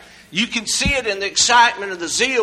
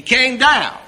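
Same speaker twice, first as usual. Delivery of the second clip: loud, high preaching cadence; full-force sermon delivery; 10 semitones higher, 8 dB louder, slower than usual. Acted. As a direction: preach it with rising fervor.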